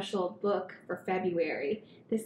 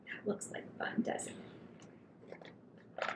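whisper; 13 dB quieter than usual. Real, not acted. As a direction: lean in and whisper. Aside to young listeners.

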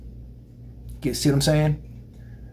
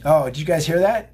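ordinary delivery

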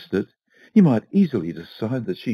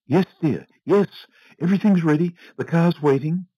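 eager wife; 9 semitones higher than usual. A narrator's reading. Wife eagerly answers her husband.

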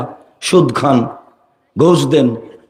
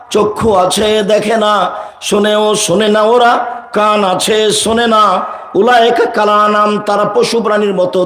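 scripture recitation; 7 semitones higher than usual. Acted. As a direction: intone each line evenly on a high held note. (ওয়াজের সুরে টেনে টেনে আবৃত্তি)

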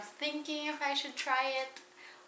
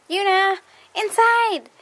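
loud, high call across the yard; 9 semitones higher, 16 dB louder, slower than usual. Real, not acted. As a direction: call the dog in, slow and loud. Calling the dog back inside.

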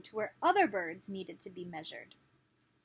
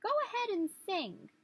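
high little bug voice; inchworm; 10 semitones higher than usual. Acted. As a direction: urge the bird on, squeakily. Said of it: "he said".

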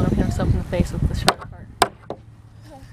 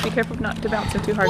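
normal talking voice

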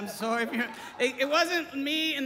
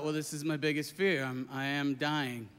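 quiet dread; 9 semitones lower, 5 dB quieter than usual. Retold or imitated. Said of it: "I thought".

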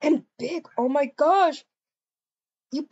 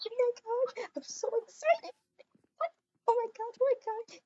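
excited squeal; 8 semitones higher, 6 dB quieter, faster than usual. Real, not acted. Waiting for friend to arrive.

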